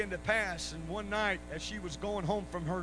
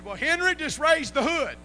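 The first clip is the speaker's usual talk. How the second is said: high, loud mock wife's call; imitating a perky wife's shouted advice; bouncy wife calling out; 9 semitones higher, 13 dB louder than usual. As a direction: holler it brightly at your husband, hammed-up. Wife calls out to husband in story.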